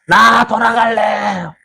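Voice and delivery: slow, high, sobbing wail of a complainer; hammed-up tearful cry to go back; crying whine. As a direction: wail it like a sulking child begging to leave. Israelites whining to go back.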